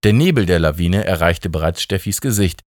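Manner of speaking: plain reading voice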